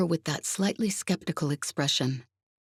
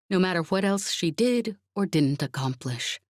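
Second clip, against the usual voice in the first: plain reading voice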